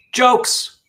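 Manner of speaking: high, loud call of the word; excited announcement. Real, not acted. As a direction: announce it with a big lift. Announcing Friday jokes segment.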